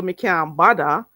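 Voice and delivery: "Me can't bother" as "mi kyahn badda"; Patois "Me can't bother", bother as "badda"; Jamaican Patois pronunciation. Shown as a demonstration. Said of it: "say it the Jamaican way".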